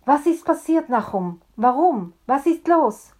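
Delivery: high, loud, urgent questions; a crowd calling out in alarm; excited shouting. A narrator's reading. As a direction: congregation shouting questions all at once.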